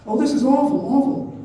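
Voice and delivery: high, loud cry of dismay; acted appalled outburst; horrified dismay. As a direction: exclaim in distress, pitched well up. Dolly reacts in horror to confession.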